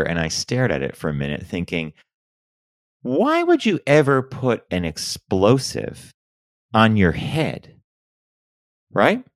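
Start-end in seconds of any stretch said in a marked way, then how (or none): 3.0-7.8 "why would you ever put an explosive on your head" spaced out slowly, high and incredulous; baffled, drawn-out "why would you ever"; slow disbelief. Real, not acted. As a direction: weigh each word of "explosive on your head", slowly, with a pause before "on". Baffled by the fireworks story.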